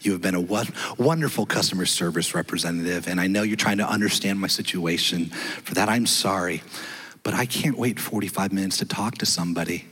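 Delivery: humbled caller apologizing to the rep; gentle apology. Retold or imitated. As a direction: soften right down and apologize. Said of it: "I became more gentle".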